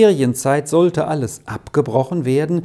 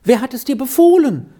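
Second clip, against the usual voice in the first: rapid, rising, urgent questions; great agitation; 6 semitones higher, 3 dB louder than usual. A narrator's reading. Efeli presses Beni about why he must leave, agitated and fast. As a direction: fire the questions off in alarm, faster and higher. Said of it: "fragte sie in großer Aufregung".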